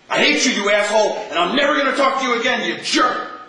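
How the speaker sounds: high, loud hammed-up yelling at someone; mock angry outburst. Teacher acting out a hateful quarrel, loud mock fury. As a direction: shout it like a furious ex-friend.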